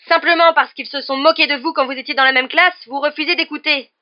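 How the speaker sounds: high, loud, outraged tirade; indignant shouting. A narrator's reading. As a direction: keep yelling your accusation at the teacher. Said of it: "s'écria alors Harry". (tirade criée, indignée)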